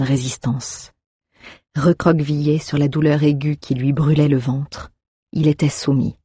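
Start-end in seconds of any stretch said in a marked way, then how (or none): none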